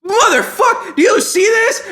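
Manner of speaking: high, loud exasperated rant; mock-furious, punching out words at high pitch; hammed-up outrage. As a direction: yell it in comic indignation. Comic outburst at ungrateful audience.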